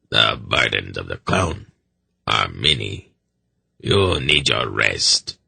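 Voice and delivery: put-on solemn, deep old servant's voice; grim seneschal. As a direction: counsel gravely in a deep rasp. Seneschal's rasping voice.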